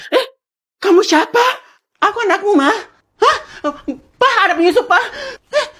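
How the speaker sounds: high, loud squealing alarm; mother shrieks to father about an intruder; squeaky panic. Acted. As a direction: shriek for help like a cartoon. Panicked high-voiced family skit.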